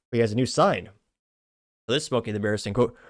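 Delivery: ordinary delivery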